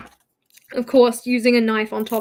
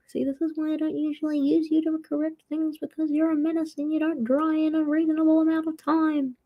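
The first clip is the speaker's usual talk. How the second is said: higher mock telling-off of a pen; mock scolding; 5 semitones higher than usual.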